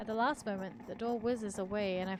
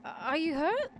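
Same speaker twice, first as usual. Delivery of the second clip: high, raised question; concerned call; 6 semitones higher, 5 dB louder, slower than usual. A narrator's reading. Voice behind the door checks on the injured narrator, calling high and loud. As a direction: call the question across a distance. Distant voice asks after narrator.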